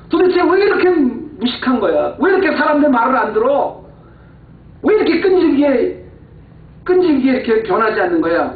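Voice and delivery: high, exasperated scolding; acted anger. Believer fuming at people who won't listen, acted angry scolding. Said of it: "내가 화가 나는 거예요".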